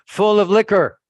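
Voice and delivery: loud, high indignant exclamation; indignant reveal. Retold or imitated. Visitor exposing the liquor cabinet behind the altar, loud and indignant. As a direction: punch out the shocking discovery, loud and high.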